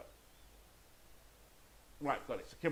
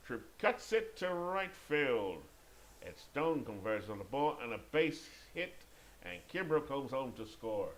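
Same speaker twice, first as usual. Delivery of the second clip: rising call with stretched opening words; play-by-play; 3 semitones higher, 4 dB louder, slower than usual. Pretend. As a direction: call the hit, then settle as the run scores.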